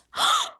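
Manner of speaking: high, sharp gasp of wonder; sudden awestruck intake of breath; amazed gasp. Retold or imitated. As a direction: gasp as if shown a revelation. Acts out amazement at God's offer.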